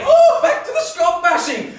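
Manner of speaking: very loud, high protest; outraged at the top of the voice; indignant shout. Acted. Scotsman protests insults.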